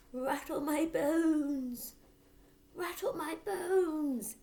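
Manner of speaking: high, very slow eerie drawl; spooky parrot. Acted. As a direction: spook the family like a ghost. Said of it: "soft and spooky this time".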